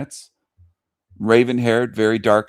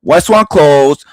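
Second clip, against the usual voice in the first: slow, much louder mock Chinese accent; hammed-up mock Chinese accent, dragged out; 5 semitones higher, 8 dB louder than usual. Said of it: "you missed the Chinese thing".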